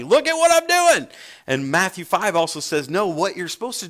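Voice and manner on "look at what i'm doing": high, loud show-off crowing; boastful shout. Acted. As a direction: announce it proudly to the whole room. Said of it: "I want to tell everybody".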